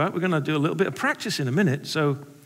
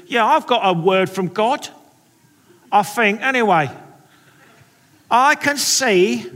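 hammed-up London Cockney, louder toward the end; cartoon Cockney accent; 3 semitones higher, 5 dB louder than usual. Sunday school teacher announcing a word from God, cartoon Cockney accent. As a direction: imitate an earnest cartoon Cockney. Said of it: "talking with what sounds like almost a kind of cartoon accent".

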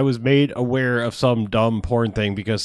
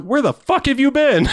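incredulous outburst; 11 semitones higher than usual. Retold or imitated. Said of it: "I'm like".

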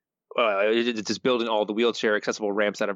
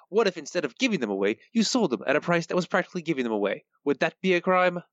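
mobster voice; 6 semitones higher than usual. Acted. Reading Fat Tony quote aloud.

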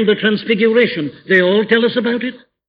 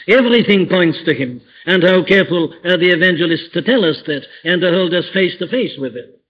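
ordinary talk, nothing marked

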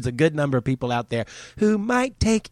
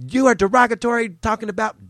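mocking complainer; 8 semitones higher, 4 dB louder than usual. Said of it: "people come to me going like".

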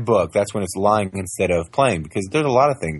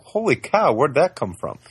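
higher, delighted astonishment; amazed; 6 semitones higher than usual. Retold or imitated. Father retelling his shock at his toddler, higher. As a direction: react as if stunned.

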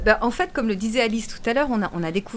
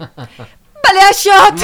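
hurried; 10 semitones higher, 15 dB louder, much slower than usual. Pretend. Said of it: "Pressé".

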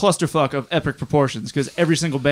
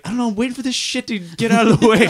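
raised, higher-pitched exasperated quote; retold complaint; 8 semitones higher, 3 dB louder than usual. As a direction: blurt it out for comic effect. Retelling impatient wait at show.

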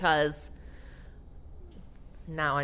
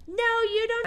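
put-on very high disbelieving kid voice; child voice; 7 dB louder than usual. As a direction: protest like a doubting student. Kids voiced in story.

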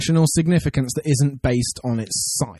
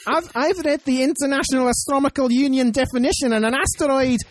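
put-on a fair Scottish accent, slightly higher; 8 semitones higher than usual. Co-host imitating Amy's Scottish pedantry, in a Scottish accent. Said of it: "I can't do the accent".

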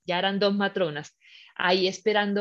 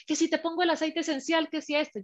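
higher, singled-out list of offers; mimicked fussing partner; 6 semitones higher than usual. Partner keeps offering oil, mimicked fussing. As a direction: mimic someone pestering with help.

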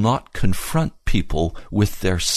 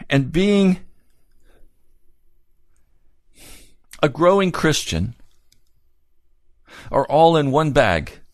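impassioned preaching; 9 semitones higher than usual. Acted. Preaches with rhetorical questions.

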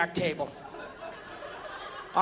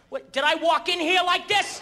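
ordinary talk, nothing marked

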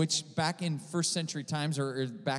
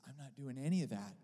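very soft, retold through tears; understated imitation of a crying man, hushed; imitated tearful reply; 10 dB quieter than usual. Brother answers during the prayer, softly imitated as tearful. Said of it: "And he's crying".

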